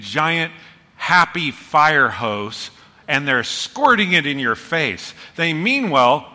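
ordinary talk

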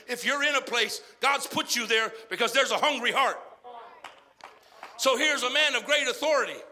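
loud, driving sermon exhortation; preaching at full force. Acted. Preacher exhorting listeners to witness, loud and fervent. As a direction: push the church to witness.

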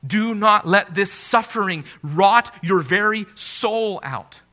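loud, slow, high preacher's cry; preaching at full force. Real, not acted. Preacher urging sufferers not to let pain rot their souls, full-force preaching. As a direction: plead it out over the congregation.

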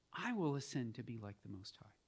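low, hushed repeat of the serpent's claim; quiet devil's boast. Shown as a demonstration. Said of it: "that's how the devil talks".

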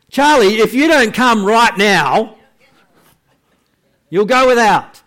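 loud, high angry threat; fed-up parent shouting a warning; calling kids to dinner. Retold or imitated. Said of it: "So you call your children for dinner".

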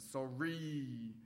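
stretched, sulky sorry; angry sorry. Shown as a demonstration. Preacher repeating the child's angry sorry, sulky. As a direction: lean hard on the sorry, resentful. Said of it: "the extra E in the angry sorry".

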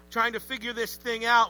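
normal talking voice

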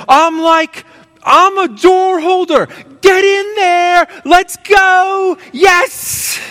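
put-on high, loud, beaming child's cheer; joyful boy cheering. Boy celebrating his door holder role: high, excited cheer. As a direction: cheer like a thrilled little boy. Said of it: "he gets this big smile".

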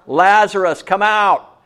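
loud, high call-out to Lazarus. Acted. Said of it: "called out in a loud voice".

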